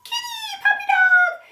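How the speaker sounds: very high pet-talk voice; high sing-song baby talk to animals. Shown as a demonstration. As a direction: coo up high at your puppy. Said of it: "talking to your animals".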